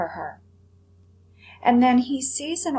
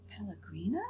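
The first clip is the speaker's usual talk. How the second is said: hushed wondering thought; 3 semitones lower, 9 dB quieter, faster than usual. Acted. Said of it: "thought the dancing rabbit".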